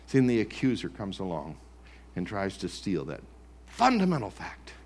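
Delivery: ordinary talk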